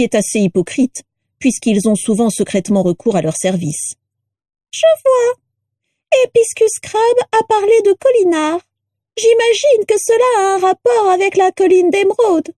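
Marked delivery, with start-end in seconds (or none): 4.7-12.5 very high, reedy musing; rattle-voiced portrait. A narrator's reading. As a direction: think aloud in a piercing little voice. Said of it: "une voix de crécelle".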